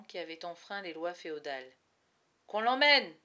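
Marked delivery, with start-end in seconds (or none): 2.5-3.2 loud, curt order; command. Acted. Falconer orders the guards to take the boy away, loud. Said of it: "ordonna-t-il".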